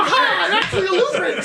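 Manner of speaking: high-pitched comic squeal through laughter; old lady's plea. Acted. Game master plays woman about to be stabbed, squealing. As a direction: squeak out a last-second protest.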